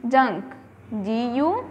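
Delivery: ordinary talk, nothing marked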